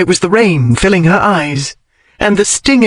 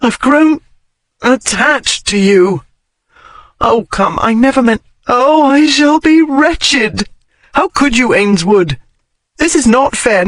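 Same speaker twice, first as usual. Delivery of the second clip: grieving plea; 7 semitones higher than usual. Acted. Grieving over him.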